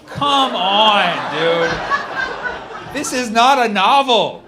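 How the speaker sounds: loud, high, comic outrage; exasperated protest. Real, not acted. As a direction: call out the absurdity at full voice. Exasperated at the stilted texts.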